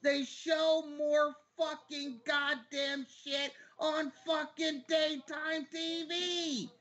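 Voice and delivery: high-pitched, indignant yelling, slowing on the last words; exasperated yell, pitched well up; yelling rant. Real, not acted. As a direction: rant it out high, hammering each word. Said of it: "why you're yelling about it".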